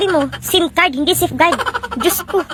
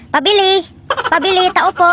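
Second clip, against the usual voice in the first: higher-pitched store call; 4 semitones higher than usual. Acted. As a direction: call for the shopkeeper's attention. Customer calling at a store. (tumatawag sa tindahan, mataas ang boses)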